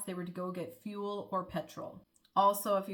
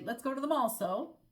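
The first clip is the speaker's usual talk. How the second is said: mall pitched higher, then so tacked on; Irish so at the end; 5 semitones higher than usual. Shown as a demonstration. Showing how the Irish end with so, Irish sentence-final so. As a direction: finish with a casual Irish so. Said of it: "they'd say".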